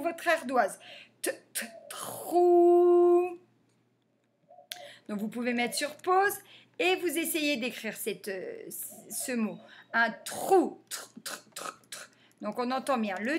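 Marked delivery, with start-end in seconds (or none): 1.2-3.4 "T, t, trou" with a high, slow final vowel; sounded out. Shown as a demonstration. Teacher dictating for the slate, "trou" sounded out slowly. 10.9-12.1 voiceless, soft repeated "tr"; "tr" repeated as bare consonants with no vowel; isolated consonants. Shown as a demonstration. Teacher helping pupils hear the letters, "tr" sounded softly.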